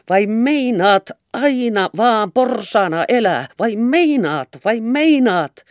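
high, loud, slow words beat out with the blows; scolding in rhythm. A narrator's reading. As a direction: scold each lash in time. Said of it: "saneli lyöntinsä tahtiin".

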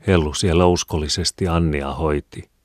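ordinary delivery, nothing marked

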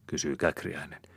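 ordinary delivery

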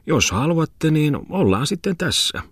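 high, wavering pitch; hesitant miller. A narrator's reading. Miller agreeing to take the lodger, tentative consent. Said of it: "mylläri arveli vain".

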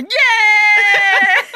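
long, high, loud cheer; victory shout. A narrator's reading. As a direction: scream with joy as the winners. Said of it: "voittajabändi rupesi huutamaan". (riemukas kiljaisu)

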